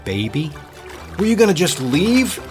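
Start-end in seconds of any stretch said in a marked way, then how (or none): 1.2-2.4 calling up. Acted. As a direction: call up, hurt. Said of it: "Gordon called up".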